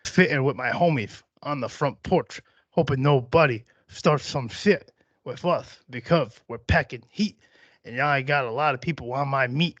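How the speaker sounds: improvised rhyme with every S slushed; cartoon-duck lisp through a loose freestyle; Daffy Duck lisp. Host freestyling about his homies, Daffy Duck lisp. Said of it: "Let's Daffy Duck this shit".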